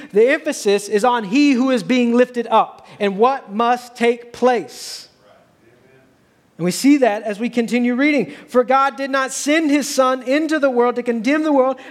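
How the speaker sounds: loud, high, driving sermon delivery; full-force preaching. Acted. Pastor to congregation, preaching at full force. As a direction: proclaim the verses to the whole church.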